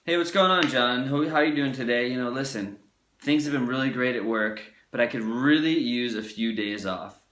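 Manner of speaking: confident employee asking the boss for days off; confident power voice. Retold or imitated. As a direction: command the room when asking the boss. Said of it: "that power right there".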